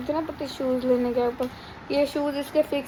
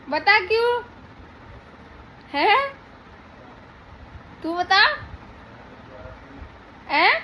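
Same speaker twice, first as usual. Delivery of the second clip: high, loud mock-accusing teasing; teasing him over the new knife; playful teasing; 5 semitones higher, 5 dB louder, much slower than usual. Real, not acted. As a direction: catch your husband out, laughing at him. Teasing husband over mangoes.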